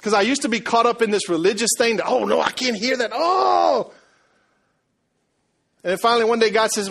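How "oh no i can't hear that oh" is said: high hammed-up panic, rising to a shout; mock horror. Preacher mimicking his old religious self hearing secular music, mock horror. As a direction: overplay scandalized alarm, climbing to a loud wail.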